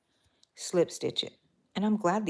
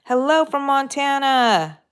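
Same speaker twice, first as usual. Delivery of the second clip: excited greeting; 8 semitones higher, 8 dB louder than usual. Real, not acted. Reading viewer greeting aloud.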